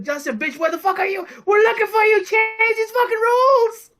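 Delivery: mock angry rant. Comedian hollering his knock knock punchline at protesters, mock outrage. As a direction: imitate a comedian yelling an outraged demand.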